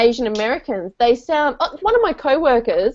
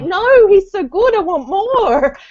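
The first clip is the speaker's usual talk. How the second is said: higher, louder and slow, pleading for more; gushing fan; 9 semitones higher, 3 dB louder, slower than usual. Retold or imitated. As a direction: whine like a fan who's hooked. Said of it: "I was like".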